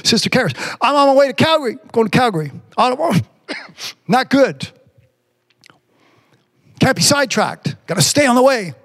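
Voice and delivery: high, driving preacher voice; fervent preaching. Acted. Preacher urging the congregation to stay on the way, fervent. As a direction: push the message home with fire.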